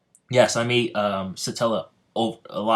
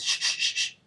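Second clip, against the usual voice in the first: shushing. Acted. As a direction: hush someone beside you. Sobaru hushing Goku during speech.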